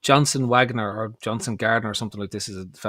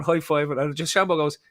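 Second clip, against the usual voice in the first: normal talking voice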